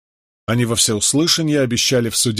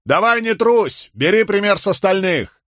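mocking shout; 9 semitones higher than usual. A narrator's reading. The wit in the crowd taunts the condemned man. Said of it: "издевательски крикнул".

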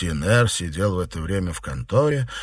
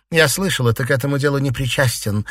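plain reading voice

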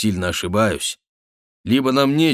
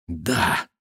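ordinary delivery

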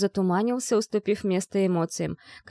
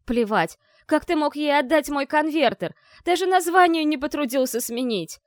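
высокий надрывный крик; ори как раненый зверь; yelling; 8 semitones higher than usual. A narrator's reading. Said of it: "заорала я, словно раненый зверь". (заорала, словно раненый зверь)

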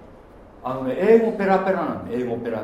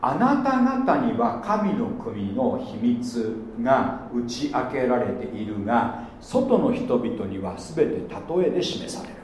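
slow scripture reading; 5 semitones lower, slower than usual. Acted. Reading Jesus' words.

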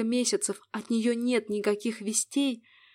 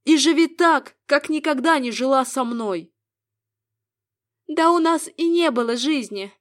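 bitter woman; 3 semitones higher, 6 dB louder than usual. Acted. Woman's parting retort.

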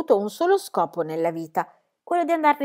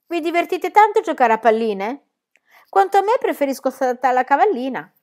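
put-on high, raised child's call; 6 semitones higher, 5 dB louder than usual. A narrator's reading. Pippi in the cave taunting two sailors, calling out. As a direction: shout cheekily across to them. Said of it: "gridò Pippi dalla grotta".

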